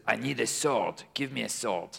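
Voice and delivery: a hint of a French accent. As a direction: lightly play a Frenchman asking in his accent. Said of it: "the French person says".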